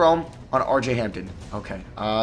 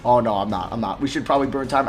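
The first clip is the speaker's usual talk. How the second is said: ordinary talk, nothing marked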